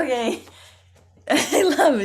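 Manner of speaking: ordinary talk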